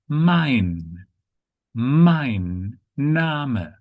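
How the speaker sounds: "mein Name" as mine NAH-muh, drawn out; German pronunciation. Shown as a demonstration. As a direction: model "mein Name" slowly for learners, end sounds clear. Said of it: "might sound similar to English".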